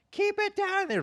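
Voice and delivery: high, loud yell from next door; roommate yelling through the wall. Retold or imitated. Annoyed roommate shouting through the wall, high and loud. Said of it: "This girl's banging on the wall".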